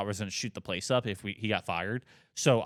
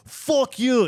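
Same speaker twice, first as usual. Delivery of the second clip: loud, very high mock curse; imitated Irish outburst; 8 dB louder than usual. Drunk Irishman cursing before he storms off, loud high imitation. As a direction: burst out like an angry Irish drunk. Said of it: "full-on Irish guy".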